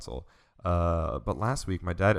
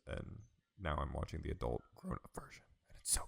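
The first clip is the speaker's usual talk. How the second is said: whisper; 4 semitones lower, 11 dB quieter than usual. Real, not acted. Reverent hushed aside about anime.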